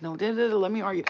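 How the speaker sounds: loud, high cutting-in; retold urgent interjection. Stopping brother from fighting so she can argue, urgent interruption.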